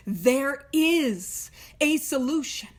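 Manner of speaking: slow, with IS leaned on and held; stress on IS. Shown as a demonstration. Reading the book to newcomers, stressing is. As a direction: land hard on "is" in "There is a solution". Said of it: "I want to emphasize a couple things".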